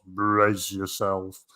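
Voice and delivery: slow, low Mister Bean drawl; slow deadpan Mr Bean voice; Mr Bean impression. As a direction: drag it out like Mr Bean. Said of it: "He said it like that".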